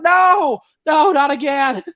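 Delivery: high, wailed mock dismay; hammed-up "not this again" outcry; mock exasperated protest. Acted weary protest at accent question.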